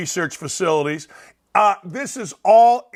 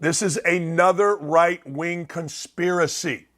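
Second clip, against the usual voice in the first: slow, measured emphasis on each word; slow, deliberate; 4 semitones lower than usual. Real, not acted. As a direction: say it slowly for importance. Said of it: "I'm gonna say this slowly".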